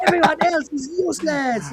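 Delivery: put-on high-pitched caricatured Chinese accent. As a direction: dismiss a rival in a hammed-up Chinese-accented vendor voice. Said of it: "stereotype of a Chinese vendor".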